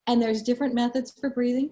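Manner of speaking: ordinary delivery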